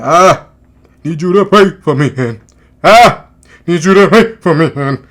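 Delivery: hammed-up mock rapper voice; mimicking a forced accent. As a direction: lay on the forced accent, punching each "Ah". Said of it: "starts talking exactly like that".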